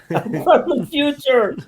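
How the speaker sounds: high, slow, laughing echo of the punchline; quoting the joke. Retold or imitated. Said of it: "I saw that on YouTube".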